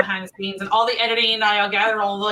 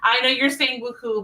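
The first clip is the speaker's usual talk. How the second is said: ordinary talk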